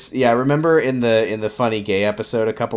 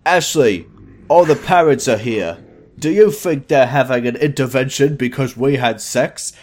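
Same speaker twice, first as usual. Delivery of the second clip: committed goofy character voice, louder, with slurred consonants; Toby; 4 dB louder than usual. Acted. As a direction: put on a silly boy's voice, "think" as "fink". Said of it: "Come on, try again".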